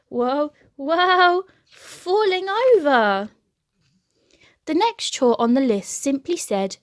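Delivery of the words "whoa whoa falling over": loud high whoas while slipping; mock alarm as he slides; rising whoa. A narrator's reading. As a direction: wobble and fall with rising cries. Slipping on polish.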